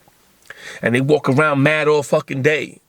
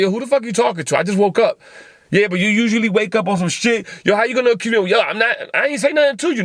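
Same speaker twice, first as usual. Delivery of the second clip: high-pitched indignant back-and-forth, not shouted; acted quarrel; 7 semitones higher, faster than usual. Podcaster acts out both sides of a roommate quarrel, indignant. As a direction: argue heatedly in a raised pitch.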